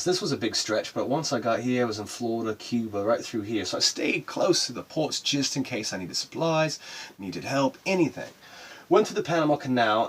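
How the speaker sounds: ordinary talk, nothing marked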